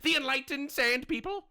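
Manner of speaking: high-pitched sarcastic indignation; mock outrage. Sarcastic outrage on others' behalf.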